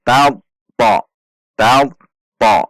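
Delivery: "Ta, ta" stopped with pressure, then released thick; two-part emphatic "Ta, ta"; pressed heavy ta. Shown as a demonstration. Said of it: "Ada tekanan dan langsung menaikkan pangkal lidah".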